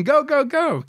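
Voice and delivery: high-pitched mock urging; imitated urging. Smuggler voices the team cheering the boat off, high urging call. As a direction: egg the boat crew on. Voicing the crew urging them on.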